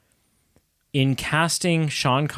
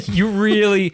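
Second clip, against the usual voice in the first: laughing; 9 semitones higher than usual. Real, not acted. Laughing mid-argument.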